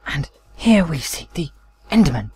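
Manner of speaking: hushed nature documentary narrator; David Attenborough whisper. Pretend. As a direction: whisper like a wildlife film voiceover. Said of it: "Tomorrow on David Attenborough's".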